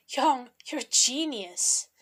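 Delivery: loud, slow, drawn-out exclamation; excited praise. A narrator's reading. Alchemist praises Young on arriving at the statue, excited. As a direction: exclaim it with delighted emphasis.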